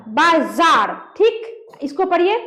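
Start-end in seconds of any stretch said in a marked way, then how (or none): 0.1-0.9 बाजार with a buzzing z, "baa-zaar"; dotted pronunciation. Shown as a demonstration. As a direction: split बाजार in two and lean on the z.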